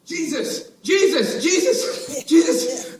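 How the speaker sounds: building from disbelief to joy; excited recognition. Acted. Peter spotting Jesus, building to a joyful cry.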